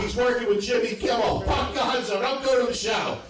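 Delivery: high, hammed-up troll protest; mock outrage. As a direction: imitate an outraged internet troll, high and insistent. Voices outraged internet troll on right.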